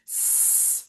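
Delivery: "S" as a long soft hiss; sustained snake hiss on "S"; little snake sound. Shown as a demonstration. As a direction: hold a voiceless sss like a snake. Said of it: "é o som da cobrinha".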